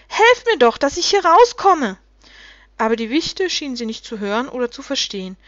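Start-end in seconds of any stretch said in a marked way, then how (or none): 0.1-2.0 high, loud, impatient plea. A narrator's reading. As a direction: demand help, out of patience. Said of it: "Ronja wurde ungeduldig".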